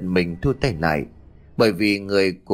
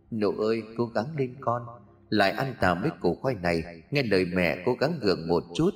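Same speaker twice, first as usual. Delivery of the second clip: soft, hushed encouragement; a softer mother murmuring comfort; tender mother; 6 dB quieter than usual. Acted. Mother begging her weak daughter to eat, soft and tender.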